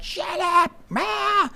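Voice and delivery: high mock tantrum shriek; screaming students. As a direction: imitate a grown-up kid screaming people down. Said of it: "and they're screaming".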